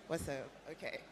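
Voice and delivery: ordinary talk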